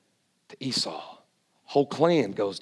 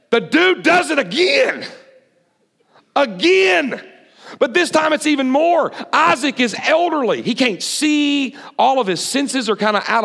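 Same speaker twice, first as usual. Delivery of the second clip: higher, louder, shouting the twist to the crowd; full-force preaching; 12 semitones higher, 4 dB louder than usual. Acted. Preacher builds up to the second betrayal, shouting. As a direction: hit the congregation with the big reveal.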